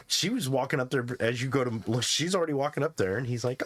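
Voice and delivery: ordinary talk, nothing marked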